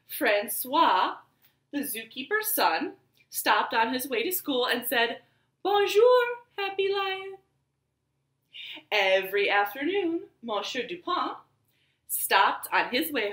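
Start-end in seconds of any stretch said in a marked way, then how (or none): 5.6-7.4 high, bright sing-song bonjour; cheerful greeting. Acted. Zookeeper's son greets the lion in a high cheery voice.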